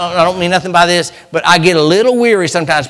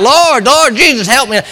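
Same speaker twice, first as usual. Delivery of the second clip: crying out to God; 8 semitones higher, 4 dB louder than usual. Retold or imitated. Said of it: "we're crying out".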